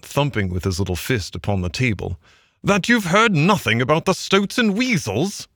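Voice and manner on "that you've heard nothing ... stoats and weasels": high-pitched, incredulous rat outburst; shouting. A narrator's reading. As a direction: cry it out high, utterly disbelieving. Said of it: "shouted the rat".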